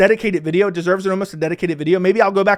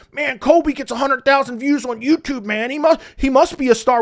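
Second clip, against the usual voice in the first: higher put-on dopey voice; mocking dumb fans; 5 semitones higher than usual. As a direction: play a clueless fan, mocking. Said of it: "you had dumbasses saying".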